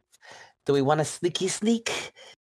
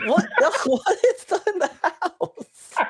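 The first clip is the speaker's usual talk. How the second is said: laughing disbelief; slower than usual. Real, not acted.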